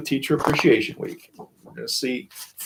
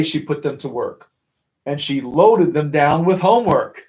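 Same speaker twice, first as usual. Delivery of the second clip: plain reading voice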